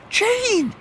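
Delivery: high shocked cry of a name; horrified. Acted. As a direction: protest in horror. Horrified protest at friend.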